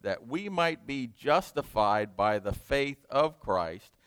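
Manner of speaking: slow, emphatic pulpit proclamation; preaching scripture reading. Acted. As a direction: read it slowly, pressing every phrase. Reads Galatians aloud at length.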